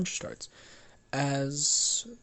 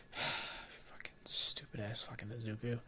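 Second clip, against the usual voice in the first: fed-up muttered swearing; exasperated grumble; 7 dB quieter than usual. Acted. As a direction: grumble it quietly to yourself. Bakugo shakes head, sighs, grumbles.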